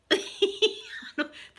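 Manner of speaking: wheezy high laughter; airy high giggle; breathless high laugh. Real, not acted.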